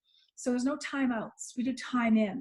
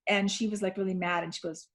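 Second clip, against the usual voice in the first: normal talking voice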